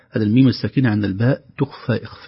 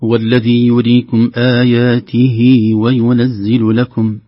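slow, louder tajweed recitation; Quran recitation demo; 7 dB louder, much slower than usual. Shown as a demonstration. Teacher reciting the verse for viewers, slow and measured. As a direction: chant it slowly for the students. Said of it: "في هذه الآيات المباركات".

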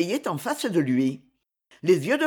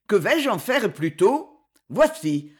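usual speaking voice

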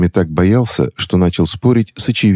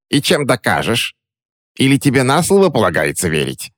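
high scornful hag voice; mocking disbelief; suspicious crone; 7 semitones higher than usual. Witch doubts the boy's word, high and scornful.

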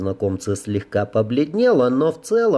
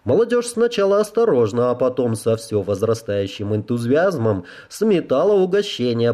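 ordinary delivery, nothing marked

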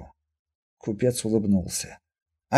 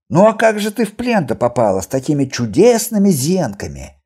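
loud, raised-pitch sarcastic question; wry jeer; 5 semitones higher, 7 dB louder than usual. Acted. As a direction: sneer at her supposedly wonderful eyes. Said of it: "скривился лекарь". (громкая язвительная насмешка)

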